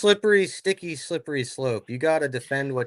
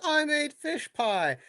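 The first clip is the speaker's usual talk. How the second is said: high, slow, innocent voice; 13 semitones higher, slower than usual. Retold or imitated. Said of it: "I said".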